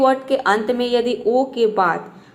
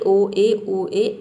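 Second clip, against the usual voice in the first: slow spelled letters; 3 semitones lower, much slower than usual. Shown as a demonstration. Teacher spells the vowel pair slowly for the class. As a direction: drag out ओ ए, ओ ए letter by letter. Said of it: "इन सभी word में क्या आ रहा है".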